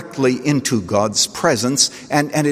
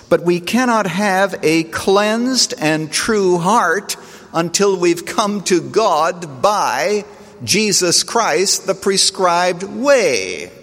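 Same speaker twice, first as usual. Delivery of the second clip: slow, weighty preaching, higher; emphatic preaching; 6 semitones higher, much slower than usual. Acted. Preacher driving his point home to the congregation, slow and emphatic. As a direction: press each phrase home from the pulpit.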